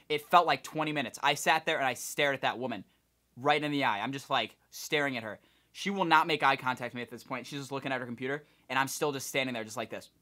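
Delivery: normal talking voice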